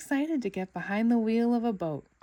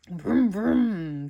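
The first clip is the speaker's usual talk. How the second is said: engine revving; 7 dB louder than usual. A narrator's reading. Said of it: "revved up the engine".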